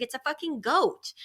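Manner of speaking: put-on high, deadpan kid voice stating the obvious; child flatly insisting to his mom; matter of fact. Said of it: "matter of fact, like".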